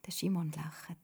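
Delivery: ordinary delivery, nothing marked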